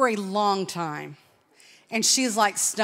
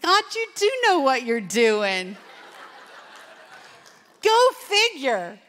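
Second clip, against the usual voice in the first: laughing, higher and louder; amused laugh; 4 semitones higher, 6 dB louder, slower than usual. Retold or imitated. Preacher tells crowd of God's timing, laughing. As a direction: marvel at God with a laugh.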